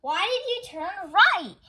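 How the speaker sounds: loud, high exasperated question; upset demand. A narrator's reading. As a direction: demand an explanation. Angry man after wrong turn.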